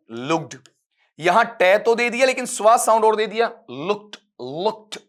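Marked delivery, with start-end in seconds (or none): 0.1-0.6 लुक्ड ending in a voiced "d", lower; wrong D sound. Shown as a demonstration. Teacher reading a confusing option, लुक्ड with final "d". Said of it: "यहां डे sound दे दिया". 3.7-5.0 लुक्ड drawn out slowly, ending in "t"; drawn-out wrong form. Shown as a demonstration. Teacher mimicking a wrong option, लुक्ड stretched slowly. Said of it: "लंबा खींच दिया".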